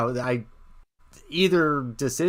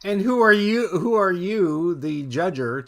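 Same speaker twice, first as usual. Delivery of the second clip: higher and louder, then slowed on the repeat; indignant challenge; 6 semitones higher, 3 dB louder than usual. Real, not acted. Host defends Sapphire against critics, indignant. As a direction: demand it, then drag the second one out.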